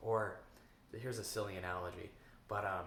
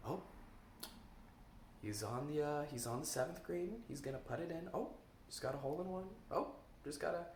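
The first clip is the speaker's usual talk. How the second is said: golf announcer; 5 semitones higher than usual. Retold or imitated. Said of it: "a way different tone of voice".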